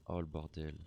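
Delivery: ordinary delivery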